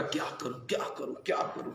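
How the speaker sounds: whispered worry. Retold or imitated. Retelling his money worry.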